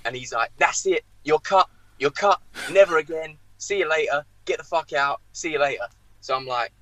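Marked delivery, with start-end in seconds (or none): 0.6-5.9 high-pitched curt mock dismissal; acted-out angry sacking; seething manager. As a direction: imitate a boss throwing a player out. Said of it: "seething, ... breathing fire".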